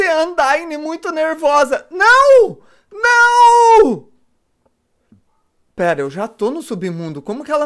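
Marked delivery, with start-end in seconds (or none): 1.9-4.1 shrieking no. Real, not acted. Knocked down by Undyne.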